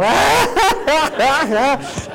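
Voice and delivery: high burst of laughter; loud laugh. Real, not acted. Laughing at school memory. (carcajada fuerte)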